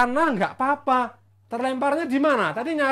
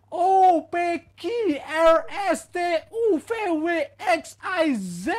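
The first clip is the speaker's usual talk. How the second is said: hammed-up cry; 9 semitones higher, slower than usual. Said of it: "dia langsung teriak".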